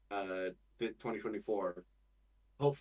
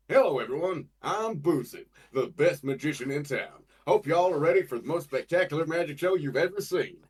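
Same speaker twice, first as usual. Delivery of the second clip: louder, higher ringmaster magician; Buzu alternate pitch; 5 semitones higher, 8 dB louder than usual. Shown as a demonstration. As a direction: boom out a grand magic-show welcome. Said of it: "So I did that along with".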